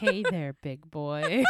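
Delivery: soft, teasing come-on; seductive voice. Pretend. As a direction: purr it like a flirty caller. Mock seductive phone line.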